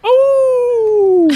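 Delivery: very high, long wolf howl; sustained falsetto wolf cry. Acted. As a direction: throw back a loud "awoo". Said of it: "gives a little wolf howl".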